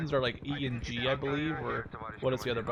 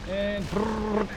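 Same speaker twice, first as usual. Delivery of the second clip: gun noise; 6 semitones higher than usual. Pretend. Imitating gunfire sound.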